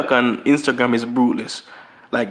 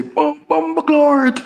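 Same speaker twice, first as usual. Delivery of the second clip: high, exaggerated Jamaican accent, mocking; jeering fake Jamaican accent, pitched high; much slower than usual. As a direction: imitate how outsiders fake a Jamaican accent. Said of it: "they always say".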